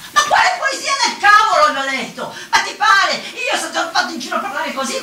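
high, loud outburst; indignant protest. Acted. Woman objecting to the gift of poetry, indignant outburst. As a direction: throw the idea back at him, high and loud. Acted quarrel over rhyming talent.

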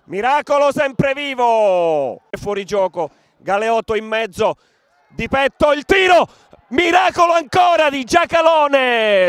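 fast running match commentary; live play-by-play. Acted. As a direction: call the action as it happens.

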